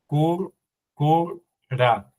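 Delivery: cur, cur, ra split into separate syllables with a pause before ra; sounding out syllables. Shown as a demonstration. Teacher sounding out the Sumerian word syllable by syllable for the student. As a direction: spell out "cur, cur, ra" one syllable at a time. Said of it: "Se duplica la R de cur".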